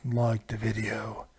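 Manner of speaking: low, slow, drawn-out menacing voice; a deep Darth Vader impression; deep slow villain voice. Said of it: "who the hell that was supposed to be".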